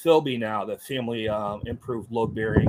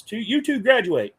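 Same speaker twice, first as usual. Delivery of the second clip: higher, louder instructor call; mock instructor announcement; 10 semitones higher, 7 dB louder than usual. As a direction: declare the two who passed.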